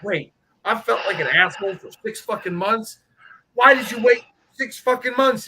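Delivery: exasperated. Retold or imitated. Retold outburst at buddy.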